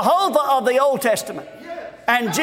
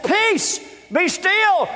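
high, ringing command; 7 semitones higher, much slower than usual. Retold or imitated. Prince of Peace to wind and waves, imitated high command. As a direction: call it out over a storm. Jesus commands the storm, acted.

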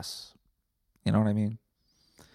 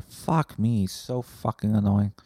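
ordinary talk, nothing marked

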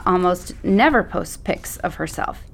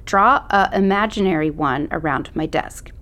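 usual speaking voice

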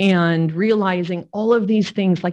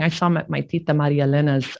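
ordinary delivery

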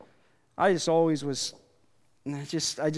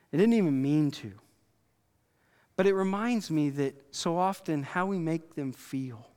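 ordinary talk

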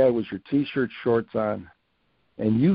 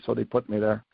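normal talking voice

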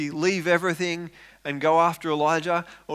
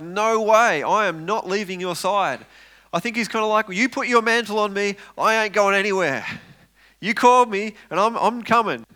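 higher and louder, insistent refusal; determined; 6 semitones higher, 7 dB louder than usual. Acted. Elisha refusing to leave Elijah's side, loud and insistent. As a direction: plant your feet, you're not leaving.

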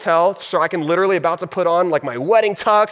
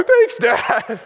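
laughing thanks; 7 semitones higher than usual. Retold or imitated.

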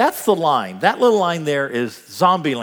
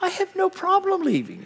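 very high, squeaky mock bravery; unconvincing denial. Husband claims to wife leaving is fine, squeakily unconvincing. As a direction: protest in a high, strained pitch.

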